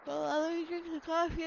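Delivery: talking through a yawn; sleepy, mid-yawn speech. Real, not acted. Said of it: "I'm yawning".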